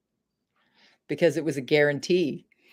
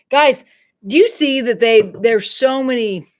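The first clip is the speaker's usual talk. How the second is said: heated outburst; 5 semitones higher, 9 dB louder than usual. Real, not acted. Pissed off about the fakes.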